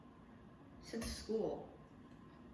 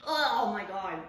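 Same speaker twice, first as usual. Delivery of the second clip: loud groaning oh my God; loud dismay at a mistake; 9 dB louder, slower than usual. Real, not acted. A mistake with the eggs.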